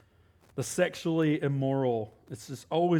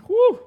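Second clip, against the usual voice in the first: whew; 10 dB louder than usual. Real, not acted. Reacting to Satan's role.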